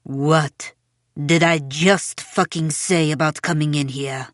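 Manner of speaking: low, emphatic, word-by-word demand; furious scolding. A narrator's reading. Mother repeating her demand to silent Belle, furious scolding. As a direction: spell out the demand with contained fury.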